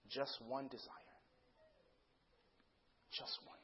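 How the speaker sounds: soft, low, slow closing; hushed solemnity. Real, not acted. Preacher revealing the sermon's title, hushed and solemn. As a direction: let it settle almost to nothing.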